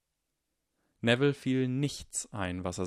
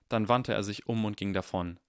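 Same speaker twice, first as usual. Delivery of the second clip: plain reading voice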